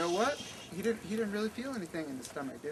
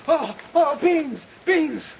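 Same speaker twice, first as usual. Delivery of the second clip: high, loud anguished cry for beans; desperate cry; 11 semitones higher, 13 dB louder, much slower than usual. Acted. Man waking at night craving beans, crying out high and loud.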